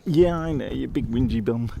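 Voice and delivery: a touch of teasing pet talk; light cat voice. Real, not acted. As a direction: tease the whiny cat fondly. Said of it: "my cat voice".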